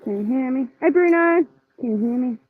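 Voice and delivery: normal talking voice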